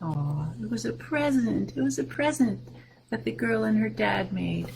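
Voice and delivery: soft, touched aw over the present; tender aside. A narrator's reading. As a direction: say it gently, moved.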